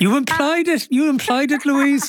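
pin it on her, all in fun; teasing accusation. Pretend. Teasing mock accusation on air.

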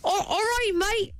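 poor imitation Australian accent. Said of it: "Do you swear that you trying?".

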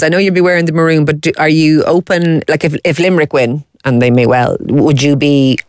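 ordinary delivery

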